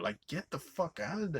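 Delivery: ordinary talk, nothing marked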